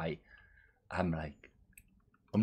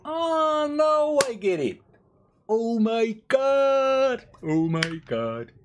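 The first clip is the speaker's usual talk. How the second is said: much higher, louder exclamation; excited realization; 7 dB louder, slower than usual. Real, not acted. Gamer suddenly understanding the mana mechanic, excited. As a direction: burst out as it finally clicks.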